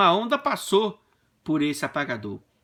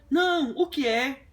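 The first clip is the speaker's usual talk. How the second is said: startled wife; 8 semitones higher than usual. Retold or imitated. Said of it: "ela se assustou e falou assim".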